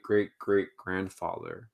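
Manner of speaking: ordinary talk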